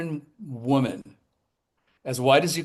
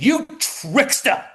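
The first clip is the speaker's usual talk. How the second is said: high, slow, put-on offended lady voice; indignant mock accusation; 11 semitones higher, 3 dB louder, slower than usual. Angry star scolding the young publicist, high and slow. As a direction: drag it out like a wounded diva. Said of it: "and she said".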